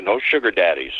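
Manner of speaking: normal talking voice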